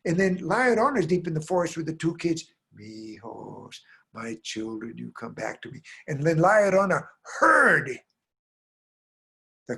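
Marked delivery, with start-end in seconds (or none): 2.7-5.8 soft, low mournful call; plaintive sorrow. Acted. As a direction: call faintly, as if from deep in the forest. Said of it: "that plaintive, crying, sorrow voice".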